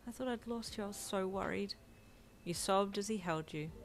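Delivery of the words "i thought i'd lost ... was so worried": soft, worried, slightly quivering; hushed relief with a slight tremble; quivering mumble. A narrator's reading. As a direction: ask gently, close to tears. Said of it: "he mumbled in a quivering voice".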